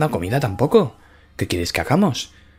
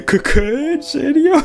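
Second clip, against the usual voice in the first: high, squeaky disbelieving questions; shocked disbelief; slower than usual. Acted. Mii reacts to Chuskis's fake eating, high shocked squeak. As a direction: rise up in amazement.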